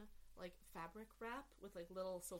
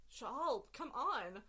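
calling out; 4 semitones higher, 8 dB louder, much slower than usual. Acted. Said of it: "Till again goes".

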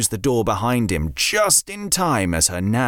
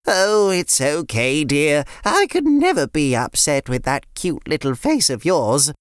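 higher, put-on grandma voice; a fond nan greeting the kids; cooing granny voice, pitched up; sweet old nan; 5 semitones higher than usual.

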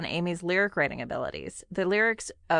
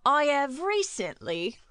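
high, slow mock English accent; 8 semitones higher, slower than usual. Host starts a listener's email, in a mock English accent. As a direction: stretch it out in a put-on English accent. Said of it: "break out my famous English accent".

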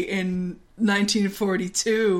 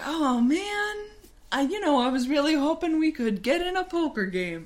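high, quick Minnesota lilt, played for laughs; hammy Minnesotan with round O's; Minnesota accent; 7 semitones higher than usual. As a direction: voice a let-down pal in a Minnesota accent. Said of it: "accidentally doing the accent again".

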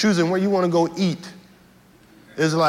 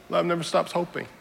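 softer, quiet echo of the refrain; 8 dB quieter than usual. Acted. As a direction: let it fall back to a hush. Refrain repeated softly.

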